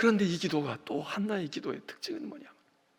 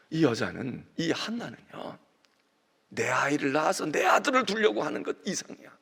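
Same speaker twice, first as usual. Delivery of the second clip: usual speaking voice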